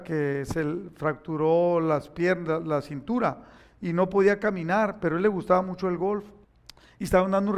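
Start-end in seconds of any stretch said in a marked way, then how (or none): none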